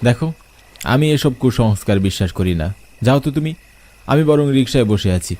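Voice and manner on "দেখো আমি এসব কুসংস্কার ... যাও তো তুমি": ordinary delivery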